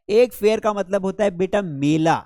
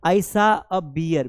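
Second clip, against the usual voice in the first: slow and clear, word by word; slow model sentence; much slower than usual. Shown as a demonstration. Teacher reading an example slowly to students. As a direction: say it slowly for the class. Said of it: "teacher models example slowly".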